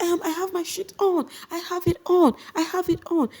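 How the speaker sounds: very high shrieking protest; mock her high defiant insistence; imitating an upset housemate; defiant Mercy.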